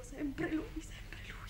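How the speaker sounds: breathless whisper, fading; hushed, lovesick whisper; whispered obsession. Acted.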